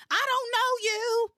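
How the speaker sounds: high and loud hammed-up soap line; mock dramatic outburst. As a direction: overplay it like a soap actress. Replaying the repetitive dialogue.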